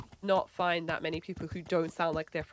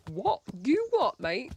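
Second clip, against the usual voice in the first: sharp "you what, mate"; Essex retort; 4 semitones higher, 4 dB louder, slower than usual. Pretend. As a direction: play a cheeky Essex bloke.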